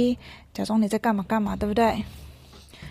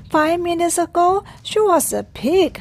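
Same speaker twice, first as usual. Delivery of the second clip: higher throughout; worked-up elephant; 7 semitones higher than usual. Acted. Elephant marvelling to himself, higher throughout. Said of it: "大象就说".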